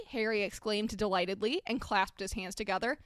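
usual speaking voice

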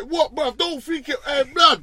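high, sharp retold challenge to the neighbour; squaring up. Shouting through the wall at the next-door inmate, hostile warning. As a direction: warn him off, hot-headed. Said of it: "I'm like".

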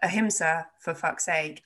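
ordinary talk

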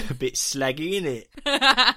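hammed-up English accent ending in a laugh; American faking English. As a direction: put on an exaggerated English accent, amused. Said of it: "Americans try and sound English".

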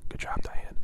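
whispered aside; breathy under-the-breath whisper. Real, not acted. Aside while reading letter.

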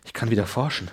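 put-on low, soft old scientist; low Werner voice. Werner marvelling at his new body, low and soft. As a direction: mutter it like a dreamy old scholar.

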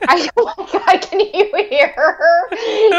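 laughing through words; giggling while talking. Real, not acted. Pug snorting, laughing.

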